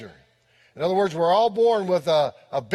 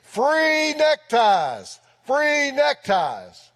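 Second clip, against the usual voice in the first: high, drawn-out vendor's cry; mock yell, stretching out each word; retold distant scream; 6 semitones higher, much slower than usual. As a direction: call it out high and slow, imitating a hawker. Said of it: "he heard someone screaming".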